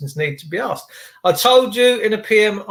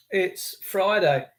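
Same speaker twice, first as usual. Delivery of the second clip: ordinary delivery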